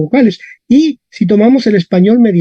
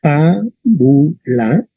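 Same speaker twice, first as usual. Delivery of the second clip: "Fábula" very slow, in three separate pieces; syllable by syllable; 2 semitones lower, much slower than usual. Shown as a demonstration. Teacher introduces the next example word, "Fábula" slowly split into syllables. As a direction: sound out fa, bu, la in "Fábula". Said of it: "Tomemos esta palabra".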